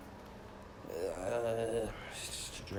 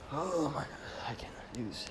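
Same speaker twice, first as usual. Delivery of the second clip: whisper; 9 dB quieter than usual. Real, not acted. Frustrated aside to himself.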